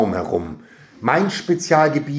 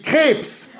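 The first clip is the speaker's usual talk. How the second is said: high-pitched, impulsive cry; shouted answer; 12 semitones higher than usual. A narrator's reading. Boy suddenly thinks he knows the quiz answer, shouting. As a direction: blurt it out as a high call. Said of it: "rief ich".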